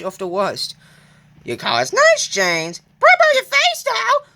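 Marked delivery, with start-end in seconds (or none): 1.4-4.3 very high, loud jeering car voice; high, loud cheeky taunt; taunting freight car. Acted. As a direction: jeer at him in a high cheeky voice.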